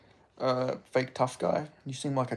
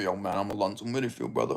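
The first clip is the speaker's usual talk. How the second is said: lower, softer tough-guy voice; deep voice; 5 semitones lower, 4 dB quieter, faster than usual. Retold or imitated. Streamer imagines the deeper voice he expected. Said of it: "a real deep, like, fucking strong voice".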